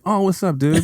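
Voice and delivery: overly casual, high greeting; fake casual cool. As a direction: play it way too cool. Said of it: "trying to be too cool".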